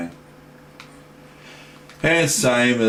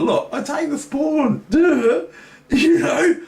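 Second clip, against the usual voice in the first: high, squeaky, half-laughing crow; gleeful squeal. Pretend. Gloating at taking the pawn, squeaky glee. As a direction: squeal with silly delight at grabbing the pawn.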